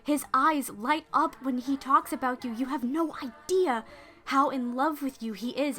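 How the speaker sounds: earnest pleading. Acted. Maid gushes to her lady about the knight, eager and bright. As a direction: gush about how in love he is. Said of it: "Lunette turns around and says".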